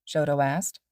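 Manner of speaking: usual speaking voice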